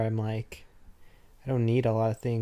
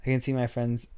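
ordinary talk

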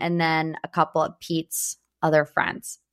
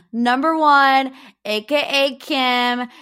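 attempted New York accent; 5 semitones higher, slower than usual. Host imitating listener Tara's question, attempted New York accent. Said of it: "You hear that New York accent?".

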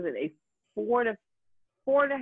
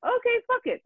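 fed-up quote; 5 dB louder than usual. Retold or imitated. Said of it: "I was like".

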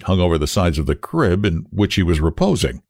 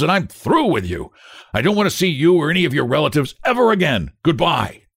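indignant, raised-pitch dismissal; cutting; 7 semitones higher than usual. A narrator's reading. Family doctor quits on the father, cuttingly. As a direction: tell him off, higher and cutting. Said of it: "answered the doctor cuttingly".

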